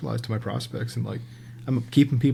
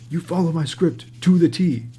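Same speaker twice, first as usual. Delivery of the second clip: higher, louder imitation of a demanding boss; mock bossy order; 6 semitones higher, 5 dB louder than usual. Director orders rep to follow his script, mock bossy. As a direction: hammer it out like a domineering director.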